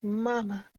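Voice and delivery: put-on high child's voice calling mama, fading; a lost child's call that trails off. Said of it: "you're still hearing these voices".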